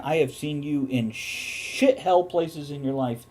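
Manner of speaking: ordinary talk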